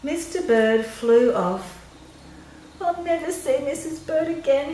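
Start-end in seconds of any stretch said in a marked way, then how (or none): 2.8-4.7 mild crying. Acted. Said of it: "he cried".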